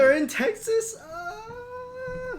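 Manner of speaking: high wailing fake sob; hammed-up crying; mock crying. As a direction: whine as if crushed by the news. Mock crying at bad news.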